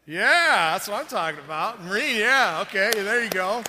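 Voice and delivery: loud, rousing shout-out to the Marines; excited cheer. Real, not acted. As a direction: whoop it up for the Marines in the crowd.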